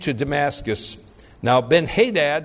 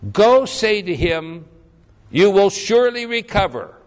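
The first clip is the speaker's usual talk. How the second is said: emphatic prophet; 6 semitones higher, 5 dB louder than usual. Acted. Elisha's reply, read with emphasis.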